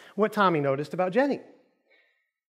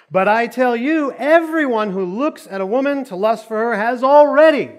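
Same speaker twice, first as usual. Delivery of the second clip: emphatic, raised preaching voice; scripture reading; 5 semitones higher, 5 dB louder than usual. Acted. Preacher reading Jesus' warning to the congregation, emphatic scripture reading. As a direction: read it out with punch, pitch lifted.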